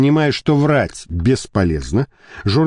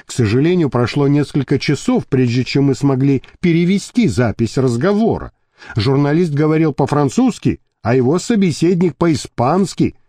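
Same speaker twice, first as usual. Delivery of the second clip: usual speaking voice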